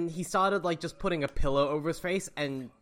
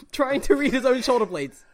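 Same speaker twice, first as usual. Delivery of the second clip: ordinary talk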